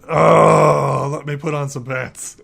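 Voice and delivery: loud, high startled yelp; jolted awake; startled wake-up. Acted. Sleeping man caught without pants, startled.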